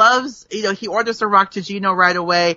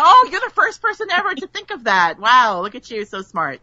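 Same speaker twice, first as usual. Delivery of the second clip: sarcastic praise; 2 semitones higher than usual. Retold or imitated. Weary target of the name joke, sarcastic praise. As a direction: gush sarcastically, then let it drop.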